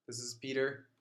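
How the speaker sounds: normal talking voice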